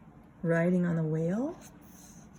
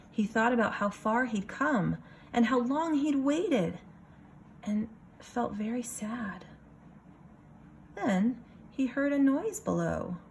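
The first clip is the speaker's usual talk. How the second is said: lively picture-book reading voice; story narration; 5 semitones higher than usual. A narrator's reading. Teacher reading a picture book to her class, storytelling voice. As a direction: read it for a room of kids.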